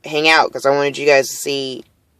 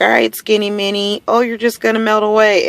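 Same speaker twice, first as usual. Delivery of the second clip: light mock of teasing relatives, pitched higher; teasing family voice; 8 semitones higher than usual. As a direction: play the relatives ribbing her, pitched up. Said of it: "they'll be like".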